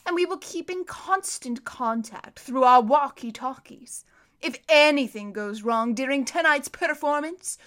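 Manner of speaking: loud, menacing villain voice; commanding villain. A narrator's reading. Count Olaf ordering the orphans out, loud and menacing. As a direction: order them about with cold menace.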